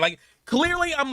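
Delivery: ordinary talk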